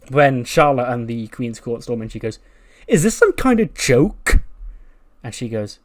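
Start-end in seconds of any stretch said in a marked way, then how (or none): 2.9-4.4 loud, high, drawn-out outrage; acted diva indignation; indignant outburst. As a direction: storm in demanding an answer. Charlotte storms into the office.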